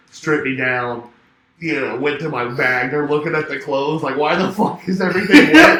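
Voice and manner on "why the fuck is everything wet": mock bewildered outburst. Acting out airport staff, laughing.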